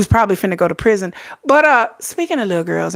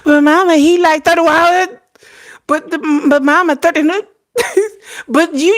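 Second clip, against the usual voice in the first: whiny daughter; 7 semitones higher than usual. Retold or imitated. Daughter insisting Sugar is young, higher and pushy. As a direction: nag like a twelve year old. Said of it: "my daughter's like".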